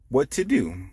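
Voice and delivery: plain reading voice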